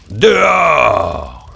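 loud, eerie mummy voice rising to a roar; the mummy roaring at the children to leave; mummy's roar. A narrator's reading. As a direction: bellow the final order. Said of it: "dit-elle".

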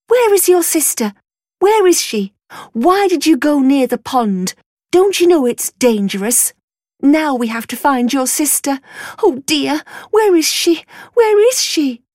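a worried mother calling out, rising to panic; frantic mother. Acted. Mother scolds her son while panicking over her missing daughter.

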